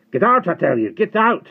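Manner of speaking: high, full-volume furious shout; vicious rage. A narrator's reading. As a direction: yell in an uncontrolled fit of rage. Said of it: "vicious rage that Will had seen".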